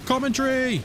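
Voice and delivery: "commentary" stretched out high; showy, lifted "commentary"; playful singsong. Pretend. Says it showily, then repeats plainly.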